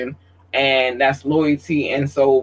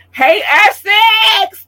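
excited squeal; 5 dB louder, much slower than usual. Real, not acted. Excited shoutout to Essex.

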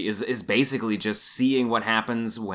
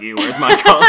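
loud, laughing mock disgust; jokey, loud fan outcry; mock fan complaint; 10 dB louder than usual. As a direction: imitate a complaining fan at full volume.

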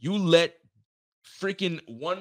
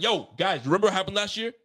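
higher, louder warning to the team; pep-talk warning; 7 semitones higher, 3 dB louder than usual. Retold or imitated. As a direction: fire up the players before they go out. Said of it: "I would have been like".

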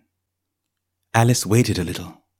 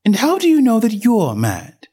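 put-on young girl voice; 11 semitones higher, 4 dB louder than usual. A narrator's reading. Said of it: "she went on".

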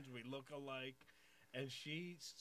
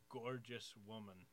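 ordinary delivery

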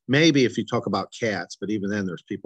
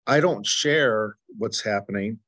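ordinary talk, nothing marked

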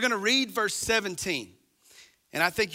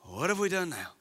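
sarcastic reply; 4 dB quieter than usual. Retold or imitated. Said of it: "as if they go".

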